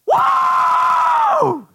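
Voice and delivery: very high loud shout; heavenly creatures crying out in awe; astonished creatures. Retold or imitated. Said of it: "creatures ... going".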